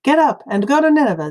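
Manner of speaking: high loud quick order. A narrator's reading. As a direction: urge him up. First telling vs second.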